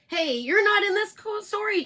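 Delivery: high indignant hen voice. Acted. Chicken Licken objects to the narrator, high indignant hen voice. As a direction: scold the intruder like a hen. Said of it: "Chicken Licken said".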